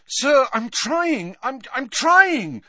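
high, loud, stammering plea; Harry, desperate. A narrator's reading. Harry failing to get water, desperate. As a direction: plead desperately, stumbling over the words. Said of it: "said Harry desperately".

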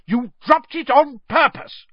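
high, loud accusing roar; furious father bellowing; angry roar. A narrator's reading. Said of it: "roared Mister Weasley".

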